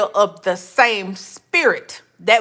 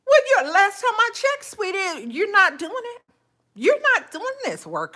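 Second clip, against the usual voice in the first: sassy retort; 7 semitones higher than usual. Retold or imitated. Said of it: "I'm like".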